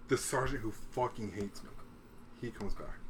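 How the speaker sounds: ordinary talk, nothing marked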